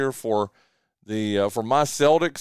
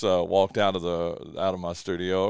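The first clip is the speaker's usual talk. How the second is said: ordinary delivery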